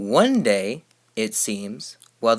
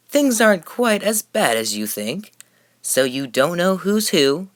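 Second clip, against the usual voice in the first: higher, sly salesman's reassurance; sly, pitched-up con man; 7 semitones higher than usual. A narrator's reading. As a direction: wink at them with your voice. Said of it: "with a very sly wink".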